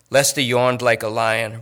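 usual speaking voice

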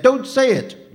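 very high, loud yell. A narrator's reading. Boy interrupts phantom speaking of death, yelling. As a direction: yell over him to silence him. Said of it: "Luca yelled".

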